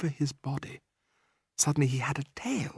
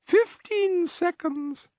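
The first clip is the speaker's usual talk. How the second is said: much higher, slow witch cry; much slower than usual. A narrator's reading. Grand High Witch counting down before the audience, gloating cry.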